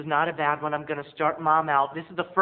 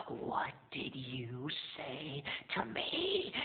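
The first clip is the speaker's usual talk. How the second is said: slow, softer, breathy threat; menacing whisper; 3 semitones lower, 14 dB quieter, much slower than usual. Retold or imitated. Mom threatening the clerk, slow menacing whisper. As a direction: hiss it slowly, deadly calm. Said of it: "and she said".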